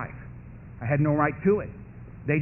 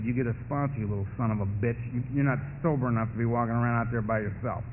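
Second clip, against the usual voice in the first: stern inner voice; 6 semitones lower, 6 dB quieter than usual. Retold or imitated. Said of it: "it was Donald Madden".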